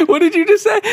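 imitating a riled-up Texan on headset; laughing mock tough guy. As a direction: squeak out his outraged challenge. Angry gamer on headset.

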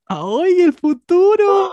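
alto y fuerte, estirando el futuro; excited squeal. Real, not acted. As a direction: chilla de emoción, lento y agudo. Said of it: "exclamación entusiasta". (grito emocionado y agudo)

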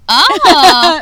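loud playful shriek; mock shriek. Playfully shrieking at her friend's cage for the beast.

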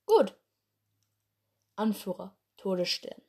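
ordinary talk, nothing marked

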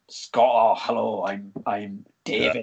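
low-pitched mock Scottish accent; joking stage-Scottish introduction. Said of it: "He spent, like, seven years in Scotland".

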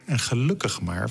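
ordinary delivery

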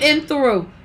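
usual speaking voice

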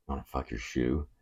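low, soft offer; low proposition. Retold or imitated. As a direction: drop down and murmur it. Acting out his private chat offer.

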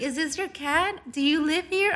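a hint of a bubbly old lady asking; perky lady. Imitating the neighbor quizzing Angie about the cat, lightly perky. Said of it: "Super perky".

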